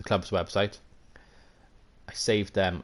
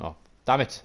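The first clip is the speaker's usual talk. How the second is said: normal talking voice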